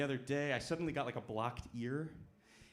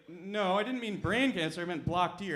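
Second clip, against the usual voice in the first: indignant correction; 4 semitones higher, 7 dB louder than usual. Retold or imitated. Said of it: "And I was like".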